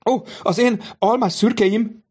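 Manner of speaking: shocked cry over her horses. Acted. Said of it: "Kiáltotta a báróné".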